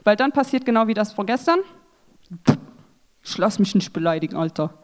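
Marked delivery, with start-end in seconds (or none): 3.2-4.7 tough mock voice. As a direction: lower and softer macho grumble. Imitating the fighting contestant.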